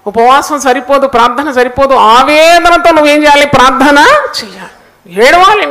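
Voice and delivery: loud, urgent exhortation; fervent preaching. Real, not acted. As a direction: drive the plea home to the congregation, forcefully. Preacher exhorts congregation to weep. (ఆవేశంగా, గట్టిగా ప్రసంగిస్తూ)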